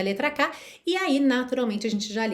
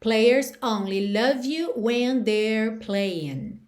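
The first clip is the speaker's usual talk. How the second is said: slow demo; much slower than usual. Shown as a demonstration. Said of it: "teacher reads chorus slowly".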